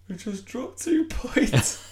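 hammed-up tearful old man, half laughing; mock crying old fan. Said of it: "just started crying".

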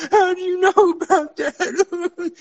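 high, slow shocked whine; mock horrified high voice through laughter; panicked victim. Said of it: "And they're like".

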